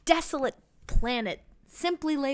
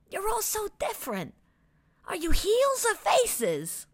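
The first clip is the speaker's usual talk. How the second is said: curious little Enzo; 8 semitones higher than usual. A narrator's reading. Little Enzo asks the crowd.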